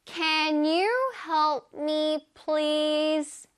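Can you help me, please? 를 한 단어씩 아주 천천히; slow model sentence. Shown as a demonstration. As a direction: read it out slowly word by word for learners. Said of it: "짜잔". (아주 천천히 또박또박 읽어 주는 예문)

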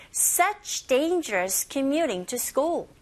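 slow, deliberate "Such dangerous commuting to school" for learners to copy; very slow teacher model; slow model reading. Shown as a demonstration. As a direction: pace it out word by word. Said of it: "저랑 똑같이 하실 수 있죠".

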